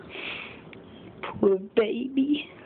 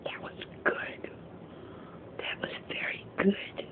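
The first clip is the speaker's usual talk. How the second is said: much softer unvoiced whisper; 13 dB quieter than usual. Cat's pretend voice praising dinner, whispered. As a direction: whisper it like a satisfied secret. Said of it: "Why are you whispering?".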